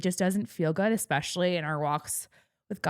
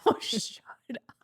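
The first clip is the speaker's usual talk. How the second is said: laughing whisper; 5 semitones higher than usual. Real, not acted. Laughing at a friend's tease.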